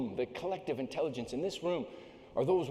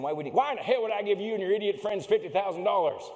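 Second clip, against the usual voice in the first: gruff Texan oilman, louder; Texas accent; 5 semitones higher, 6 dB louder than usual. Retold or imitated. Houston oil boss scoffing at the climber in a Texas accent. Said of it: "I apologize for the accent you're about to hear".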